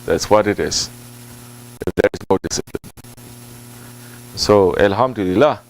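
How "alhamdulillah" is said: ordinary talk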